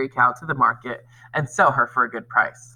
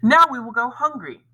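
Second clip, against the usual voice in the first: angry mother; 8 semitones higher than usual. A narrator's reading. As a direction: scold like a fed-up mother. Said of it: "cried his mother angrily".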